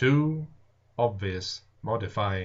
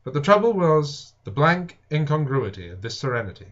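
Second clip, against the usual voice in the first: plain reading voice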